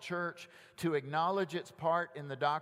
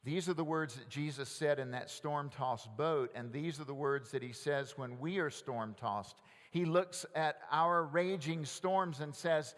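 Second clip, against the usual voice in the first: usual speaking voice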